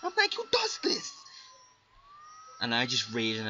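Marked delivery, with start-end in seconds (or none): 0.0-1.1 high mock-raging whine. Gamer retelling his rage at the opponent, high whine. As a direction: rant at the scrub, pitched up. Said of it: "I'm just, like, raging".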